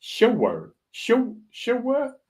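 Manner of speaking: "sure" stretched to two syllables, "shoo-wer", rising high; a mock Geordie "sure" with the added syllable; Newcastle sure. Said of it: "They go".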